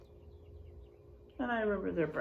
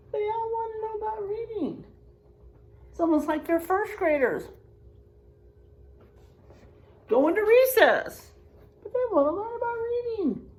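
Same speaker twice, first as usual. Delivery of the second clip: high, fast, thrilled teacher voice; excited; 11 semitones higher, 6 dB louder, faster than usual. Real, not acted. Excited comment to children.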